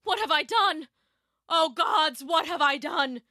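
high-pitched lament of horrified regret; anguished cry. A narrator's reading. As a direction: cry out to the gods in remorse. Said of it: "he cried".

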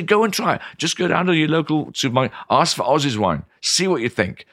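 high, insistent urging; eager wine expert persuading; encouraging retort. Acted. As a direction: coax the sceptic to give it a go. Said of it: "I'd say".

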